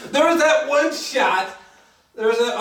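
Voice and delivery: ordinary delivery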